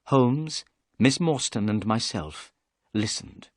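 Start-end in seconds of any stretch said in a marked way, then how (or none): none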